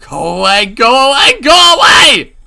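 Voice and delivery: builds from loud to a very high scream; escalating scream. Acted. Keiichi pulling the door on Rena, escalating scream. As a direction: scream harder each time.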